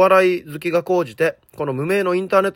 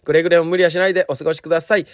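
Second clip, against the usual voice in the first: usual speaking voice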